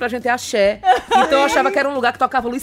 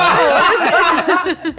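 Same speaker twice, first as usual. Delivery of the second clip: eager yes; 8 semitones higher, 6 dB louder than usual. Retold or imitated. Retold answer in mix-up.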